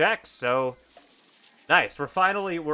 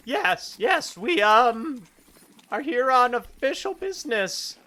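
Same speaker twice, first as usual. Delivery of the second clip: much higher nervous bluff; nervous Akir; 11 semitones higher, 4 dB louder, slower than usual. Acted. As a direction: stammer the cover story. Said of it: "Akir says".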